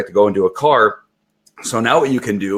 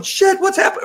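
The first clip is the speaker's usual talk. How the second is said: much higher, panicked yelp of words; mock alarm, pitched way up; startled dog. Trainer voices a dog shocked by too high stimulation, startled.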